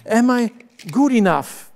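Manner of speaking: ordinary talk, nothing marked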